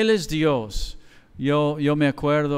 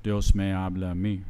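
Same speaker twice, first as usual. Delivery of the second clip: mocking quote; 9 semitones lower, 5 dB quieter than usual. Neighbor mocking the pastor.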